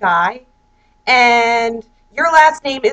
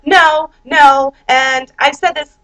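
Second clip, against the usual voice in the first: normal talking voice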